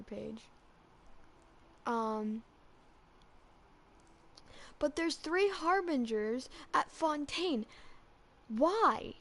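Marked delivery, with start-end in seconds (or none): none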